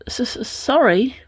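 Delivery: slow, high, stuttered apology; startled stammer. A narrator's reading. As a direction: stammer it out as if caught off guard. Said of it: "responded a startled Roman".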